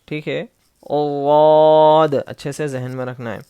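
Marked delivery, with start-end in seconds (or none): 0.9-2.1 slow thick واد, louder; said bold. Shown as a demonstration. Teacher shows students a heavy letter, slow and bold. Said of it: "موٹا پڑھنا ہے".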